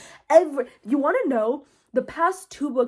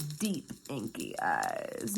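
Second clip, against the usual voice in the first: very low, slow, dragged-out mocking read; mock swooning; 10 semitones lower, 11 dB quieter, much slower than usual. Reader mocking a hater's admiring description, low mocking drawl. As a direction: drop low and drawl "deep, inky eyes".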